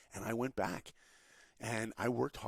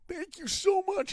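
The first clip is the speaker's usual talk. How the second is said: squealing thanks, much higher and louder; overjoyed high squeal; 8 dB louder than usual. Retold or imitated. Young animator thanking his mentor on the phone, high joyful squeal. Thanking after getting internship.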